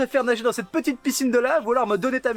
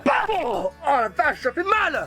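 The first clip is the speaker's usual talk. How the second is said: high-pitched mock pain; yelping in pain; 8 semitones higher, slower than usual. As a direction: cry out as if just hit. Frappé, se plaint de douleur. (cri de douleur joué, aigu)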